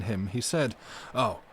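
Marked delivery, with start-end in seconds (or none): 1.1-1.4 louder, firm denial; decisive refusal. Acted. Madman refusing the doctor's suggestion about souls, loud firm denial. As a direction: push the no out hard and certain. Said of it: "shaking his head with a decision".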